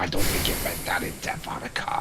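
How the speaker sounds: soft whispered aside; whispering. Real, not acted. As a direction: whisper it under your breath. Whispered aside after tutorial text.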